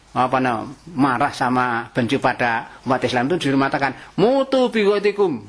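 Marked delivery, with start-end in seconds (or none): 4.2-5.4 Quran recitation. Acted. Quran verse quoted in sermon.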